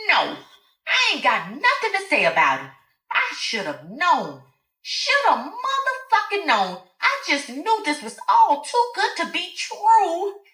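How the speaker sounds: betrayal boiling over; crying out in rage. A narrator's reading. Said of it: "she cried".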